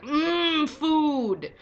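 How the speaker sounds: high, loud hungry creature voice; creepy monster. Retold or imitated. Sea creature after eating sailors, loud hungry gloat. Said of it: "the creepy Predator thing saying weird shit like".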